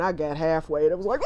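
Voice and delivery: usual speaking voice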